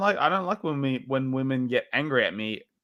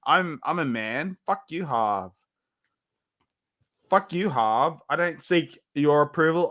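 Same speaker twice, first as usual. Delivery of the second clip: normal talking voice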